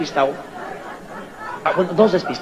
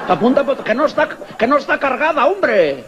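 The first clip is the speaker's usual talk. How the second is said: exasperated insisting; 8 semitones higher than usual. Acted. Gun holder insists it's unloaded.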